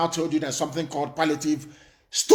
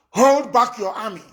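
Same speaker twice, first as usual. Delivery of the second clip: loud, higher demand; shouted warning; 6 semitones higher, 6 dB louder than usual. Real, not acted. Warning the government to hold back its army, shouting. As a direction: bark the order at the government.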